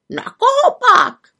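very high, loud bird question; squawking raven. A narrator's reading. Raven asking who angers the witch, squawky voice. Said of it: "opakoval havran Abraxas".

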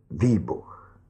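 usual speaking voice

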